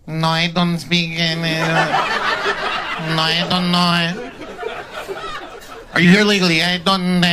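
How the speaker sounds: mock Spanish-accented stammer; mock Mexican dad. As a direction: play the scared dad stalling the officer. Dad voiced in ICE stop.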